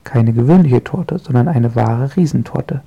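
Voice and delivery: plain reading voice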